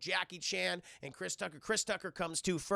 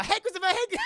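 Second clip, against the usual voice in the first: higher, louder line broken up by laughter; giggling impression of the line, far higher and louder; laughing mock quote; 13 semitones higher, 10 dB louder than usual. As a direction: laugh your way through the movie line. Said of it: "what's the one line that Jackie Chan says".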